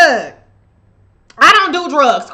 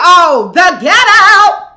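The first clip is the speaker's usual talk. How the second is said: scornful quip; 6 semitones higher, 9 dB louder, slower than usual. Retold or imitated. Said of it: "I said".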